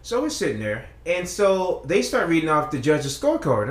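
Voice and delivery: usual speaking voice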